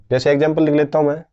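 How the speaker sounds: ordinary delivery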